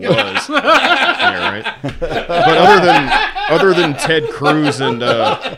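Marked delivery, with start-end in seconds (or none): 0.5-5.6 laughing. Real, not acted. Laughing at dick joke.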